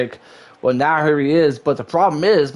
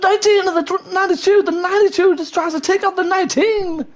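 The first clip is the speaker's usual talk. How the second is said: excited. Real, not acted. Sudden wreck attempt, live call.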